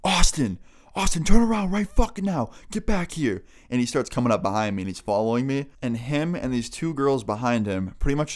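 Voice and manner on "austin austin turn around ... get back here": raised, high-pitched angry yell; loud, high mock scream; retold scream. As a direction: imitate someone yelling after a person walking off. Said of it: "he's screaming at me".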